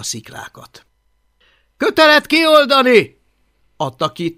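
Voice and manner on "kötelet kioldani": put-on loud, high, swaggering boy leader; bossy bully leader. Boastful Viking boy bossing the others, loud and high. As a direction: bark orders like the self-made chief.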